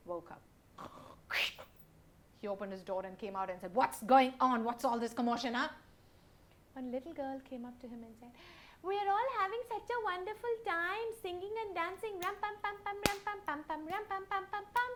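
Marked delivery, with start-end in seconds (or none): 3.8-5.7 loud, gruff roar; angry demand. Acted. Chief woken angrily. 8.8-14.9 put-on high, sweet child voice chanting; little girl telling the chief.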